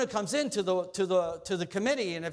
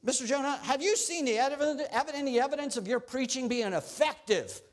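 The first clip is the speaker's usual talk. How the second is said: higher, keen interviewer voice; eager committee member; 7 semitones higher than usual. Acted. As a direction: ask Jonah the last question eagerly. Committee member asks Jonah.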